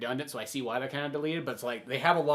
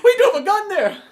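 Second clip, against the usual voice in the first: laughing mock quote; 13 dB louder than usual. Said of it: "He's like".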